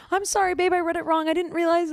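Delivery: high-pitched, pleading retold apology; acting out a teary, small-voiced sorry; tearful apology. Girlfriend apologizing to boyfriend after misreading his text, acted tearful. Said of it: "I, like, teared up".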